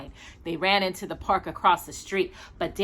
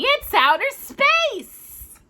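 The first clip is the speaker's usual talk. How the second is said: insistent; 3 dB louder, much slower than usual. Acted. Danny insists to Walter.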